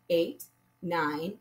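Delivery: ordinary talk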